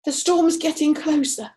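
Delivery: high, loud shout. Acted. Mr Burbank shouts to his wife about the storm, loud and high. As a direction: call out over imagined thunder. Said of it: "Mister Burbank shouted".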